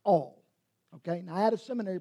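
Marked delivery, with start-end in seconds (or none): 0.0-0.4 All said alone and punched louder; emphatic. Shown as a demonstration. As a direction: hit All as a one-word line. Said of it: "wants that idea emphasized".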